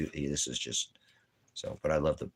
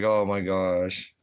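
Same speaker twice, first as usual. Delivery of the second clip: slow, let-down oh my gosh; disappointed groan; 4 dB louder, much slower than usual. Retold or imitated. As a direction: drag it out in dismay. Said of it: "I was so disappointed".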